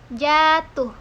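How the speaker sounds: "jatuh" with the first syllable "ja" drawn out long. Shown as a demonstration. Lecturer to students demonstrating duration, "jatuh" with a long "ja". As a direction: stretch the "ja" in "jatuh", slowly. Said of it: "diucapkan panjang".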